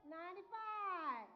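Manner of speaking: loud, slow drawn-out call; shouted out. Real, not acted. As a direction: call it out to the back of the room. Calling out the age again.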